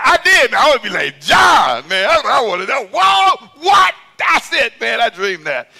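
very high, loud outburst; worked-up yell, easing at the very end; excited shouting. Real, not acted. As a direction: holler it out, carried away by the memory. Excited outburst about admiring John.

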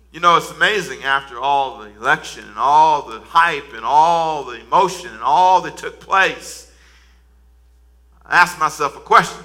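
ordinary delivery

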